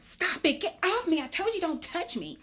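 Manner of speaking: high-pitched mock whiny protest; irritated teenage son. Nineteen-year-old fending off mom's sunscreen, acted irritation. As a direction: imitate a kid snapping at mom. Acts out son snapping at his mother.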